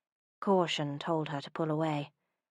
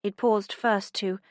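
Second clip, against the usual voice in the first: plain reading voice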